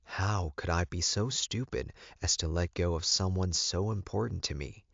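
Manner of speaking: ordinary delivery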